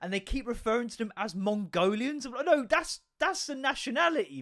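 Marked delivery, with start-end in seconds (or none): 2.4-4.4 acted high-pitched protest; mock-outraged correction; indignant objection. Acted objection to the old video.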